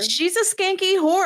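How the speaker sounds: mock outrage. Mock jealous insult.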